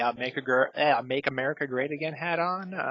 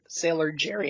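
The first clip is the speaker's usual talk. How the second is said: ordinary talk, nothing marked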